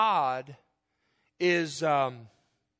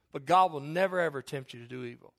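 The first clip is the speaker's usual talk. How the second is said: ordinary talk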